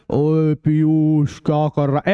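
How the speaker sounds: low, slow, muffled call as if underwater. Shown as a demonstration. Teacher showing how a voice sounds under water, muffled and slow. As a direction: stretch it out like sound through water. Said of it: "ऐसे सुनाई देगा आपको".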